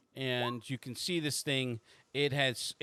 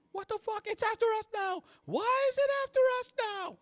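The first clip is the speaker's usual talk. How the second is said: very high falsetto shrieks; panicked screaming; 7 dB louder, faster than usual. Acted. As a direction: build to a loud, panicked scream. Abby panics as monster follows.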